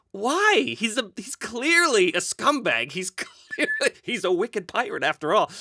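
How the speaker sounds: high-pitched comic protest, laughing midway; exasperated disbelief. Retold or imitated. Host protesting fans' love of a scumbag, in comic exasperation. Acts out his disbelief at fans.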